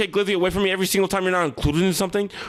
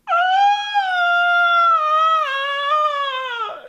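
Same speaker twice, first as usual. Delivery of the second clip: long high loud scream; 4 dB louder than usual. Acted. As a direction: scream as you get shoved out. Pushed out the door.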